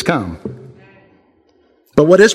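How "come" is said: much lower, soft single word; a quiet, calm invitation; low gentle come; Jesus's simple call. Retold or imitated. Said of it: "He just says".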